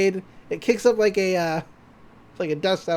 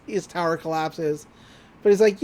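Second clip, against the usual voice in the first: normal talking voice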